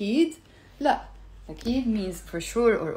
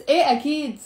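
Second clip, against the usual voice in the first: sarcastic إيه أكيد, louder timbre on a fall; sarcastic yes; 4 semitones higher, 3 dB louder, much faster than usual. Shown as a demonstration. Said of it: "it doesn't mean I'm saying yes".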